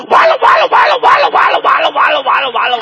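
high shouted "完了" siren that fades away; police siren imitation. Comic mimicking a police car siren wailing. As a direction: wail "完了" like a police siren, dying down. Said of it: "叫唤出那声".

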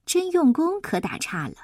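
ordinary delivery

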